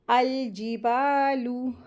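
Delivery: अल जिबालू with long held baa; stretched madd. Shown as a demonstration. Teacher models madd on ba, जिबालू slowly stretched. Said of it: "इसको दो हरकत खींची पढ़ेंगे".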